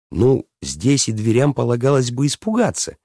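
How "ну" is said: plain reading voice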